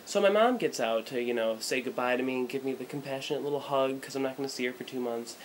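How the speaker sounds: usual speaking voice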